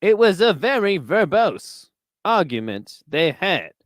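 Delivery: wordy sportscaster with bobbing pitch; pompous commentator savouring big words; Howard Cosell. Acted. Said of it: "You always gotta, you always gotta use, like, a big verbs".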